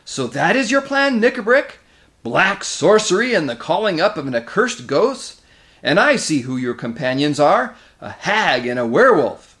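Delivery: loud, high, indignant denunciation; furious king's voice ringing out; thundering outrage. A narrator's reading. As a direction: thunder at a traitor. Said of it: "rose the voice of Caspian like thunder".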